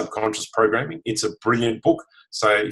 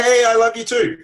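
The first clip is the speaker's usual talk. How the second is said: much higher, louder bright hey; cheerful reply; 12 semitones higher, 6 dB louder than usual. Pretend. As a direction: answer back happily from the mirror. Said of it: "it might come back and go".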